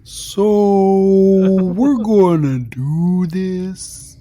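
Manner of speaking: loud, very drawn-out whale speech, pitched high; speaking whale. Acted. Cabal tries to speak to his excited companions, speaking whale. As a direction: stretch every word into a swooping whale call. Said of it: "it's like speaking whale".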